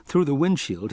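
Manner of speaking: plain reading voice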